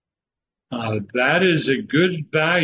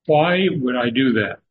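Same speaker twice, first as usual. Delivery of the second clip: usual speaking voice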